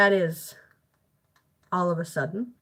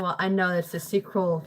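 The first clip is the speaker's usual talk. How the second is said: ordinary talk, nothing marked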